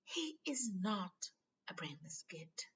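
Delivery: high, slow, dropping to a fierce whisper; shrill protest. Acted. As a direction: defend him sharply. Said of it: "said Hermione shrilly".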